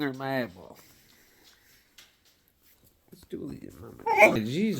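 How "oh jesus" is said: sudden high startled cry. Real, not acted. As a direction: jump at the noise. Startled by dog.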